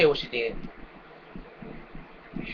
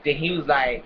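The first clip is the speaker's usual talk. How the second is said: ordinary delivery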